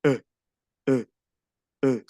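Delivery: short French schwa E, like a slack "uh"; three separate short dopey E's; dull "boba" E. Shown as a demonstration. Teacher demonstrates the silly French E to learners, dull short schwa. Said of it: "es una E boba".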